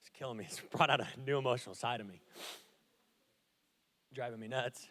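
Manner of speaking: quiet, low and choked up; tearful. Real, not acted. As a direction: speak through tears. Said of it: "I'm crying a lot today".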